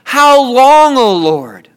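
high, loud plea falling away; crying out. Acted. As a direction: climax of a preacher's cry to God. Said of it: "We cry out".

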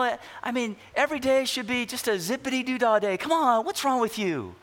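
trite cheerfulness. Pretend. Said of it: "someone just comes up to you and says".

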